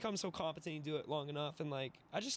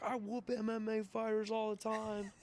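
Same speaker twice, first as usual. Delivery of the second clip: high, drawn-out mock yell; retold drunken-brawler yell; 6 semitones higher than usual. Wedding guest held back by friends, mock yelling. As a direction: imitate a loudmouth yelling, stretched out and high. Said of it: "I hear someone screaming".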